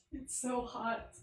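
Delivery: dragging each word out in the heat; wilting from the heat. Real, not acted. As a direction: let it sag out, overheated and drained. Complaining about the heat again.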